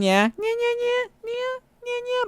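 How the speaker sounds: put-on very high, slow cat-girl nyas; squeaky anime cat meow-talk; cutesy nya speak. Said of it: "Want me to nya?".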